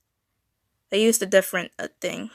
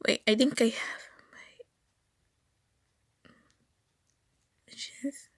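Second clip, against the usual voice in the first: whispering; 2 semitones higher, 7 dB quieter than usual. Real, not acted. Muttering to herself while searching.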